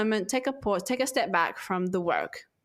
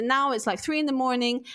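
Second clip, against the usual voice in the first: ordinary delivery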